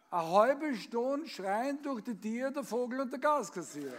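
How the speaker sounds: lively storytelling recital, acting out each voice; comic poem recitation. Reciting a comic poem for the birthday audience, performed recital.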